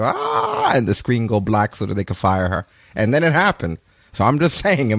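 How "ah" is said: short high imitated scream; a quick hammed-up shriek; mock scream. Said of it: "I was already in my head going".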